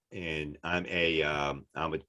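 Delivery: ordinary talk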